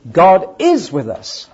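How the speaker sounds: slow, with is leaned on; vote call with is stressed; loud and slow, weight on is. Shown as a demonstration. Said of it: "How many?".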